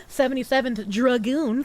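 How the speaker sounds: ordinary delivery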